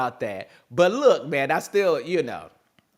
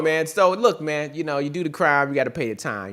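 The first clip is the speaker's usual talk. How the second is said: usual speaking voice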